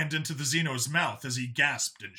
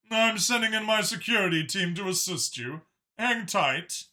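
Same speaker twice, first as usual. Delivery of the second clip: higher, measured counselor voice; 6 semitones higher than usual. Acted. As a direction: speak carefully like an official. Counselor Mach speaks over comm.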